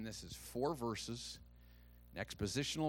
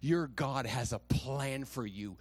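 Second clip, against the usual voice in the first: ordinary talk, nothing marked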